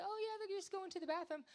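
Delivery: high, airy unbothered voice; mock breezy voice. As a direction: shrug it off sweetly. Casual acceptance voiced.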